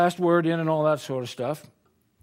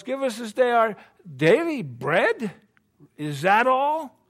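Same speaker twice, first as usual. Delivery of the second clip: mock indignant question; 6 semitones higher, 4 dB louder, slower than usual.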